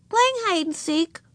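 put-on high innocent child voice; little girl answering. A narrator's reading. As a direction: answer like an unbothered little sister, pitched high. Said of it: "answered Ramona".

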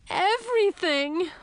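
high, drawn-out wail. A narrator's reading. Upset princess answering what's wrong, high wail. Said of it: "I wailed".

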